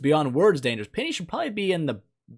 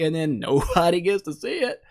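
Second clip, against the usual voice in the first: usual speaking voice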